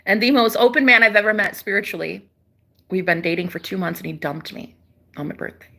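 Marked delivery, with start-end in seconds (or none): 4.0-5.7 wounded whisper. Real, not acted. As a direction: drop to a hushed whisper on the sore detail. Heartbreak confession drops to whisper.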